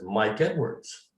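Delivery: ordinary delivery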